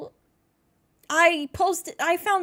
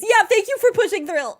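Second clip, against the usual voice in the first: gushing; 6 semitones higher, 11 dB louder than usual. Real, not acted. Gushing thanks after idol's comment.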